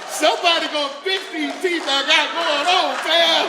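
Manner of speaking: high, loud shout to the crowd; hollered boast. Real, not acted. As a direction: holler it out, pushing up to the last word. Hollers punchline to the audience.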